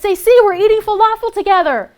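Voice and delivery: loud, high sing-song mockery; a loud high mocking voice for people boasting over falafel; mock naive cheer. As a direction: parody their smug togetherness, loud and high.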